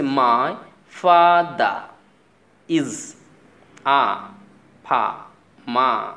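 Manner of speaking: "father" and "farmer" ending in open "ah", a pause after each word; British silent r, word by word. Shown as a demonstration. Teacher repeating for students word by word, "father" without r. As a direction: spell it out loudly, "farmer" as "fah-mah". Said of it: "আমি কিন্তু বলেছি".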